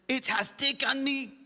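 high, robotic German-accented imitation. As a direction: mimic a stiff anglicized German accent. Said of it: "my slightly German anglicized accent".

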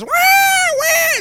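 piercing, high mock wail; imitated loud baby cry. Dad mimics his newborn on the table, loud wail. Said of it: "And she is so loud".

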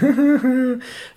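high-pitched run of giggles; imitated little-kid titter; childish giggling. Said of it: "like, giggle, giggle, giggle".

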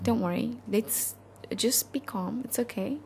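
soft, soothing husband voice; husband soothing his panicking wife; calming husband. Retold or imitated. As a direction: gently reassure her. Husband calming her in story.

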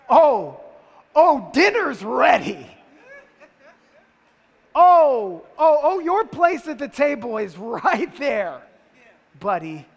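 loud, slow mock-sweet mother's threat; sarcastic mom. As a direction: play a mother's sarcastic warning at dinner. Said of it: "Your mom ever do that to you?".

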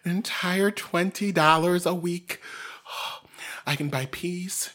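mock excited Gertrude. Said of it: "Gertrude was like".

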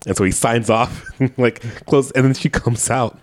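ordinary talk

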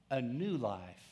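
ordinary talk

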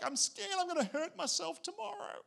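put-on high, small scared child; child's fearful voice. Father imitates his daughter's bedtime fear in a high voice. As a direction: lightly voice a frightened little girl. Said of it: "She's like".

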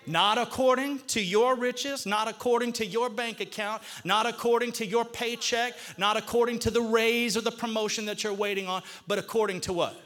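rising, emphatic preacher cadence; preacher hammering the point home; preaching. Acted. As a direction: build each not according to. Pastor preaches building list.

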